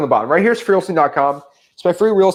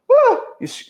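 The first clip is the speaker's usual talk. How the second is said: squeaky nervous voice. Shown as a demonstration. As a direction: voice jumps way up into a squeak. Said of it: "your voice squeaks up a little".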